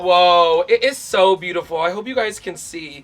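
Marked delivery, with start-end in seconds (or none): 0.0-1.8 loud admiring gush; awed. Real, not acted. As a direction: marvel at it. Admiring mirror.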